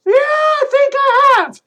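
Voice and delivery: put-on high, loud kid puppet voice; bright high puppet calling a friend. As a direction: call out like an eager little puppet. Puppet Troy answers Milt.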